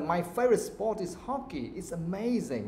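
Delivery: "hockey" and "amazing" lifted high with moving pitch; positive intonation. Shown as a demonstration. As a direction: lift "amazing" to show you love it. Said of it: "So for example".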